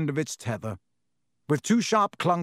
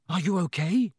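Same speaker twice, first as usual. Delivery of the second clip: urgent; 3 semitones higher than usual. A narrator's reading. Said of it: "Harry said urgently".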